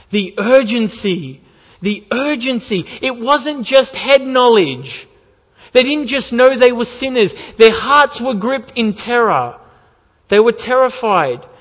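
loud, high preaching on urgency; impassioned preaching. Acted. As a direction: stir them with the terror. Preaching at full force.

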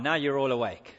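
usual speaking voice

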